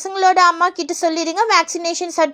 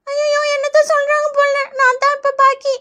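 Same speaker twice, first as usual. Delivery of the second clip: put-on high, whiny kid aside; worried child; 6 semitones higher than usual. Pupil dreading their turn, worried child voice. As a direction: fret like a schoolkid next in line.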